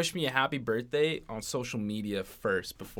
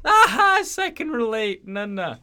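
high, loud laughing squeal breaking into words; a dad laughing till he cries at a joke; dad laughing to tears; 12 semitones higher, 11 dB louder than usual. Retold or imitated. Son imitates dad crying with laughter at videos. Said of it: "I could just picture my dad crying".

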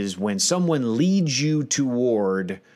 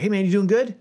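cheery leading greeting; 11 semitones higher, faster than usual. Retold or imitated. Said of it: "when people are like".

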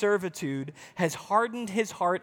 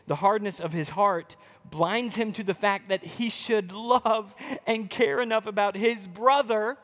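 ordinary delivery

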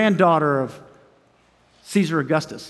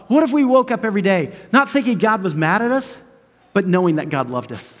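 ordinary delivery

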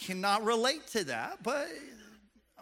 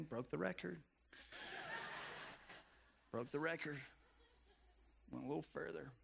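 softer, muttered private gloating; inner thought; 11 dB quieter than usual. Retold or imitated. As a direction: say it to yourself, quietly pleased. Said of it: "He's also thinking".

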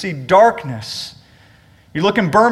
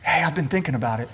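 mock whisper; 4 semitones lower, 7 dB quieter, much faster than usual. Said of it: "lean over and whisper in your ear".

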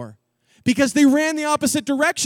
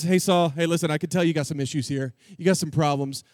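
put-on casual teen; 7 semitones lower, faster than usual. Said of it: "And he says".

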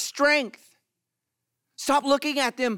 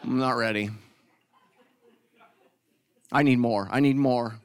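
unready worshipper; 9 semitones lower than usual. Retold or imitated. Reluctant worshipper as music starts, low grudging stalling. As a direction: stall like worship came too soon. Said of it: "you're like".

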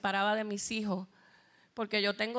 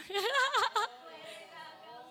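laugh; 10 semitones higher than usual. Real, not acted. Laughs at her own remark.

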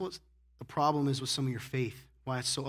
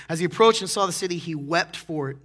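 louder, quicker push on approached, then slowing on wept; emphatic reread; 3 semitones higher, 7 dB louder than usual. Shown as a demonstration. Pastor rereads the verse to the congregation, emphatic and loud. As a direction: preach it again with rising force. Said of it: "He comes down and says".

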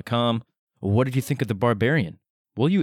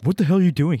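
higher, louder startled outburst; startled yell; 7 semitones higher, 4 dB louder, faster than usual. Retold or imitated. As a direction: blurt it out, alarmed. Acted startled quote.

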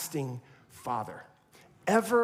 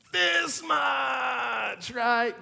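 big playful shout; 7 semitones higher, much slower than usual. Retold or imitated. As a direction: throw the arms wide and call it out. Arms wide, biggest answer.

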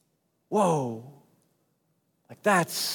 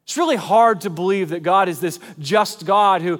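usual speaking voice

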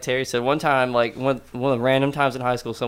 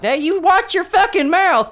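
very high, slow, loud mock scold; mock scolding mom; 6 dB louder than usual. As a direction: snap at them like a mom. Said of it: "my mom's also like".

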